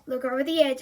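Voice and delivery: ordinary delivery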